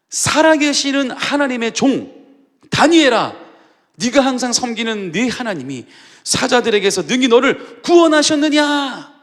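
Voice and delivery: a grieving king calling into the lions' den; sorrowful cry. Retold or imitated. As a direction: cry out to the den, hoping for an answer. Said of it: "슬피 소리질러 다니엘에게 묻되".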